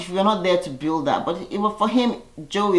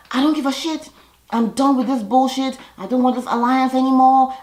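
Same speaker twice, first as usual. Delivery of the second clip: higher, a touch louder retold outburst; mock yelling; 8 semitones higher, 3 dB louder than usual. Lolo yelling at Tom and Kato, mild mock yell. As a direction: mimic Lolo blowing up, half-hearted. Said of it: "And Lolo goes crazy".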